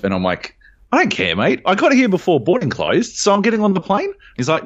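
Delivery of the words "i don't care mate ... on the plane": defiant retort. Retold or imitated. Retold argument with gate agent.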